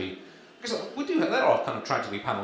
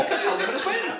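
chirpy guest; 5 dB louder than usual. Acted. Hutchins's reply in the joke.

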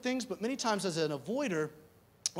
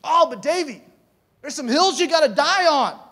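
raised, high-pitched protest; loud objection; 8 semitones higher, 13 dB louder than usual. Acted. Congregant objecting to the pastor's point, loud and high. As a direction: push back loudly, voice pitched way up.

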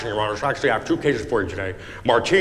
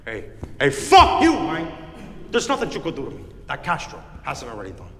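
Scarface defiance; 3 semitones higher than usual. Acted. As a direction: spit it like Tony Montana.

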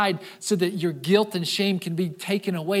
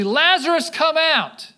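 higher and louder, a commanding call; authoritative command; 7 semitones higher, 5 dB louder, slower than usual. Retold or imitated. Jesus calling Lazarus from the tomb, commanding and loud. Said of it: "says authoritatively".

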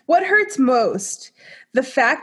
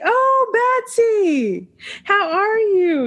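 high excited greeting; 10 semitones higher, much slower than usual. Retold or imitated. Greeting the older lady, acted.

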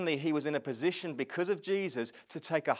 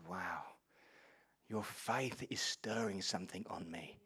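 hushed awed Jesus; 8 semitones lower, 11 dB quieter than usual. Retold or imitated. Jesus voiced in retelling.

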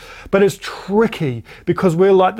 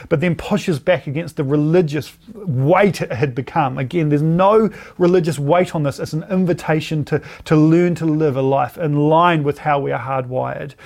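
normal talking voice

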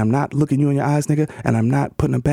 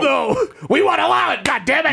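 high mock shout; 12 semitones higher than usual. Mock protest refusal.

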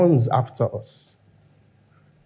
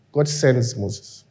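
ordinary talk, nothing marked

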